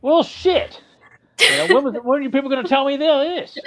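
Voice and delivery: high, startled outburst; surprised. Real, not acted. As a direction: caught off guard, pitch jumping up. Startled at news of absence.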